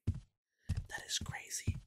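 slow, fully whispered. Real, not acted. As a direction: whisper it slowly. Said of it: "repeated whisper".

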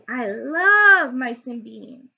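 slow, loud, savoured words; lazy loud drawl of delight; drawn-out declaration. Acted. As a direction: linger over every word with relish. Hero declares love of food.

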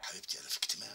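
unvoiced hushed whisper. Real, not acted. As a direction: whisper into the phone. Father whispers in meeting.

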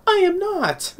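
very high, drawn-out falsetto protest; mock indignant denial. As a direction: squeal the denial like a cartoon character. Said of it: "Mora was saying".

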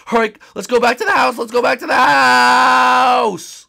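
high, loud call ending in a long yell; urgent retreat shout. Acted. Protector calling the group home, urgent yell. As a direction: shout the retreat, stretching the last word.

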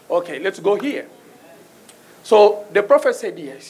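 usual speaking voice

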